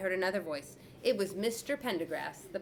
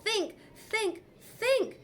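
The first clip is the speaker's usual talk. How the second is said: much higher, rising to a shout; boy panicking, pushing himself; frantic self-urging; 4 dB louder, much slower than usual. A narrator's reading. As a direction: urge yourself to think. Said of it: "hit my forehead".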